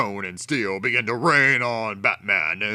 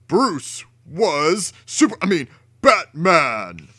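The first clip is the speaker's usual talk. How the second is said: ordinary delivery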